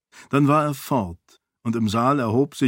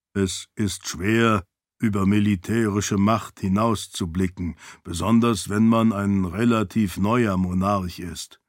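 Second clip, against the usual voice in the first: low servant voice; 4 semitones lower than usual. Acted. Servant asks his lady, low calm voice. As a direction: ask it low and measured.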